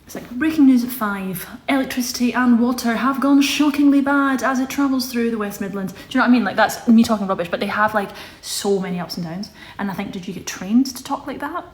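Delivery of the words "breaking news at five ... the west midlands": journalist voice. Shown as a demonstration. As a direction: announce it like breaking news on TV. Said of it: "Does he have the journalist voice?".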